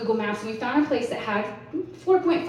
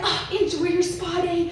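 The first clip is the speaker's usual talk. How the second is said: cheery valet; 7 semitones higher than usual. Retold or imitated. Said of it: "they're like".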